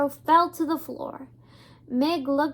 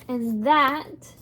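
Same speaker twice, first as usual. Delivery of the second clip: plain reading voice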